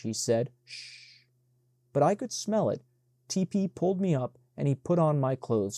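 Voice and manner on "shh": a breathy whispered shh with no voice; whispered shush. Acted. T P soothing Benji in the dark, whispered shush. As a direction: hush him without waking the house.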